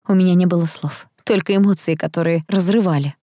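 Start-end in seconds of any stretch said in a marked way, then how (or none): none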